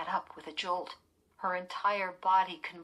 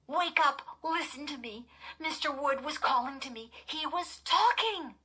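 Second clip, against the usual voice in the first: high, pleading urgency; frightened girl rousing her sleepy sister; urgent alarm; 9 semitones higher, 4 dB louder than usual. A narrator's reading. Frightened girl urges sister to wake.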